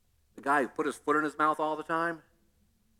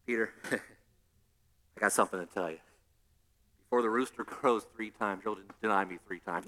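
quiet Jesus voice; 11 semitones lower, 9 dB quieter than usual. Retold or imitated. Jesus replying calmly to Peter.

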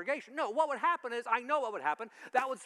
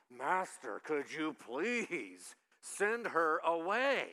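pleading disciples; 3 semitones lower, much slower than usual. Acted. Disciples begging Jesus.

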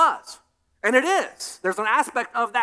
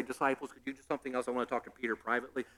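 much softer, quick private murmur; mock hushed aside; 8 semitones lower, 12 dB quieter, much faster than usual. Jesus asking disciples for privacy, softly and quickly. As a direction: hush it as if aside. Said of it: "didn't, like, whisper that".

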